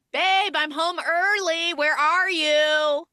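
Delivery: high, drawn-out sing-song call. Retold or imitated. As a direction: call through the apartment as an arriving girlfriend. Acting girlfriend calling out offstage.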